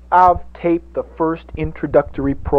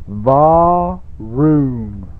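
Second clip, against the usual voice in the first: engine sound; 2 semitones higher, 3 dB louder than usual. Shown as a demonstration. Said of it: "say it with me now".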